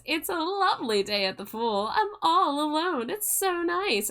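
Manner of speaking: put-on airy, rising valley girl. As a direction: play a ditzy valley girl. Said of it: "doing a Valley Girl voice".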